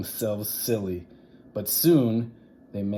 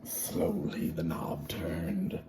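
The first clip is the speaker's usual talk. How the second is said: low, slow, quiet ominous telling; suspenseful narration; 4 semitones lower, 6 dB quieter, slower than usual. A narrator's reading. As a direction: draw out the creeping dread. Suspense before Swamp appears.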